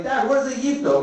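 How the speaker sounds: high-pitched little question; imitated puzzled kid. Boy asking dad what a word means, high puzzled voice. His child self asks dad.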